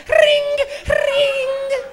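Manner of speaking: loud, high ring ring; bike bell. Retold or imitated. Comic imitating a cop's bike bell, loud and high.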